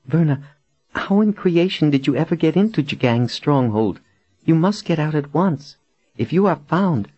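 high-pitched woman's voice, alarmed for her friend; frightened, anxious captive woman. Acted. Captive warns her rescuer she must get out, anxious.

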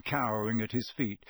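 usual speaking voice

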